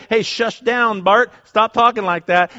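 mock scolding. Crowd shushing the blind man, mock scolding.

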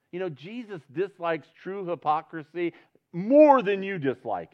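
loud, high, surging preacher's voice; impassioned preaching. Real, not acted. Pastor preaching fervently to the church, loud and high. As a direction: preach it out with full fervor.

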